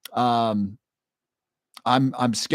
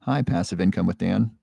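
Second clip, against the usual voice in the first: ordinary delivery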